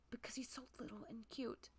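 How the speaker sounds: soft, breathy whisper; adoring whisper. Real, not acted. Player gushing because he's so little, adoring whisper. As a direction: whisper it as if overcome by cuteness. Gushing over the cute villager.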